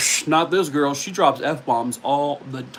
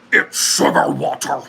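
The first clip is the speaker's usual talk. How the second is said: movie quote impression; 5 dB louder than usual. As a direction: breathe the line like the film character. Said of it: "this one comes with another impression".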